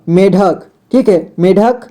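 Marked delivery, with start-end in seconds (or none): none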